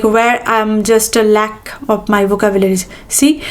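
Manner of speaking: ordinary delivery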